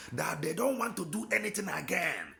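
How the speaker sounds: ordinary delivery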